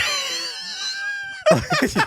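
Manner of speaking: high, shrieking laugh; burst of laughter. Real, not acted. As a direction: crack up at your own joke. Laughing at own impression.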